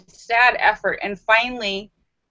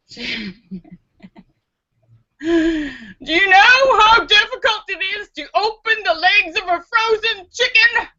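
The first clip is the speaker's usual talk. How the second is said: laughing punchline; 9 semitones higher than usual. Acted. The parrot answering its owner, laughing through it. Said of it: "the parrot replies".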